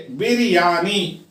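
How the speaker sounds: "बिरयानी" broken into three beats; syllable by syllable. Shown as a demonstration. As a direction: sound out बिर-या-नी for learners. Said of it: "इसके तीन syllable है".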